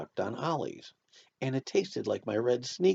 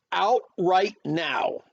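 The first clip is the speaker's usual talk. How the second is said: slow, loud command to leave; loud, higher and drawn out; stern order; 7 semitones higher, 6 dB louder, much slower than usual. A narrator's reading. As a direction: order the child out, word by word. Mother ordering child out of room.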